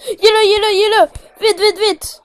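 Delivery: loud, high, frantic panic; panicked shouting. Real, not acted. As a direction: yell it in full alarm. Monster appears in game.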